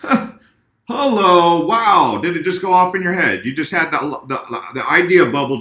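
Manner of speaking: high, loud teasing wow; mock amazement. Mocking viewers' sudden realization.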